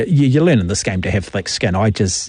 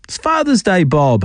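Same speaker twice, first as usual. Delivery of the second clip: high, teasing sing-song aimed at Bob; mocking sing-song; 6 semitones higher than usual. Radio host taunting texter Bob, high mocking lilt. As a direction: needle him with a high singsong.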